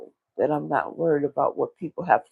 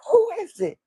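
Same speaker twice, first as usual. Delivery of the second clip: high-pitched irritated "who is it"; upset door call. Retold or imitated. Upset, calls through the door.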